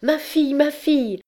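very high, alarmed call; frightened cry. A narrator's reading. Mother sees her daughter fall fainting, frightened. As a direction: cry out in maternal panic. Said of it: "cria la mère effrayée".

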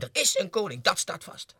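loud, high conviction; fierce insistence. Acted. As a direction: declare it beyond doubt. (vastberaden uitgeroepen)

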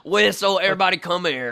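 loud, high coach call. Pretend. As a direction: gather the kids like a coach. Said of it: "This is my coach voice".

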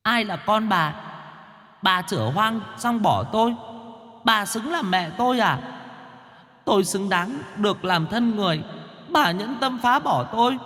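very high, thin accusing voice; shrieky but soft-volume ghostly reproach; angry ghost child. A narrator's reading. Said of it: "quát lên giận dữ".

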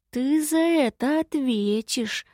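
high, slow, drawn-out threat; threatening. A narrator's reading. Departing guest warning the imp, threatening. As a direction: stretch it out as a warning. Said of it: "пригрозил гость".

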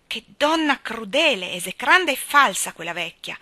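loud, outraged exclamation; indignant cry. A narrator's reading. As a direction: cry out in condemnation. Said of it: "gridò Anna".

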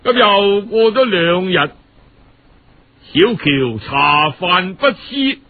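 usual speaking voice

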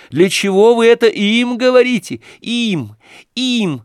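громко и высоко, в ярости; выкрикнуть обвинение; негодующий крик. A narrator's reading. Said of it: "в последней степени негодования". (негодующий крик Аглаи)

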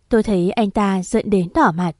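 plain reading voice

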